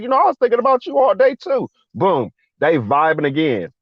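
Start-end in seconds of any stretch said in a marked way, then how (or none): 0.0-1.7 much higher, quick eager reply; high-pitched, hurried lovestruck quote; retold smitten woman. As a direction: imitate her answering eagerly, pitch way up.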